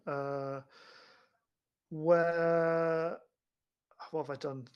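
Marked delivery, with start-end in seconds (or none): none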